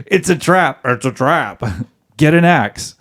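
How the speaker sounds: hammed-up film-line warning; movie quote. Husband warned of a trap, mock movie alarm. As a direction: call it out like a movie hero. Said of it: "Army of Darkness".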